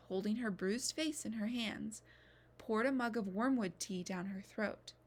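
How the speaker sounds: usual speaking voice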